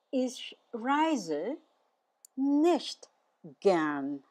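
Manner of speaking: "Ich reise", pause, "nicht", pause, "gern"; deliberate teacher's model with clear gaps; word-by-word demo. Shown as a demonstration. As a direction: separate the words for learners to follow.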